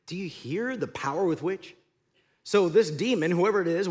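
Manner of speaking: usual speaking voice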